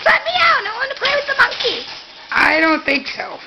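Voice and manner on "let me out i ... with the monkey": a light high dog voice, then rushed; voicing the dog. Owner voicing Luna begging to play, light high pleading voice. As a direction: speak for the pup, quick and pleading. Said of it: "She says".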